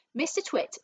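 ordinary delivery

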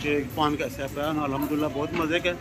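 talking around large paan; mouth full of paan. Real, not acted. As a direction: cheeks stuffed while speaking. Seen 2: Large paan put in mouth at -4s; cheeks stay puffed throughout.

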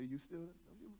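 soft, low, half-asleep question; hushed mock-sleepy check-in; drowsy phone murmur. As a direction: imitate waking up on the phone and checking. Acted drowsy phone call, roles swapped.

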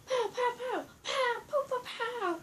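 high, soft pow-pow effects; play battle noises. Pretend. Skit fight with Godzilla, high soft pows. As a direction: squeak out little pretend blasts.

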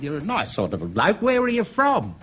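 lightly put-on woman's voice; a hint of a chatty barmaid.

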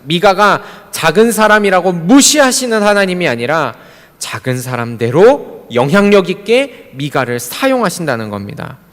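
high, forceful pulpit proclamation; full-force preaching. Real, not acted. Pastor urging beloved church members not to judge themselves, full-force preaching. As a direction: proclaim it to the whole congregation.

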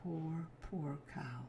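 ordinary delivery

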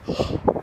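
quick breathy gasp; startled little intake; mock gasp. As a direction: gasp as if caught out. Gasp after secret slips.

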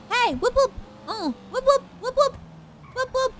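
high whooping siren noise; siren imitation. Mimicking a police siren.

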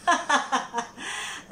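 short breathy gloating laugh. Acted. Farm owner gloats over the thief in the well, gloating laugh.

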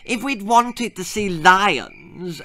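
put-on slow, high, sulky spoilt boy; grumpy high boy's voice, unimpressed. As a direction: drag it out like a bored brat. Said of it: "added a very grumpy Percy".

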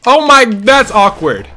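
high, slow, stretched exclamation; loud exclamation. Real, not acted. Player in an awkward fight, loud exclaiming. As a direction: draw it out very loudly.

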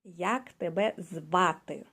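"Як тебе звати" very slow, stress on "тебе"; slow teacher demo. Shown as a demonstration. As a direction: spell it out slowly for the learner. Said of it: "You can start to read".

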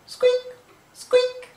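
very high, loud squeaks; toy squeak. Acted.

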